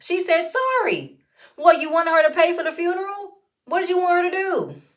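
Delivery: high, loud indignant questioning; incredulous and forceful; exasperated challenge. Real, not acted. Exasperated defence of Kandi.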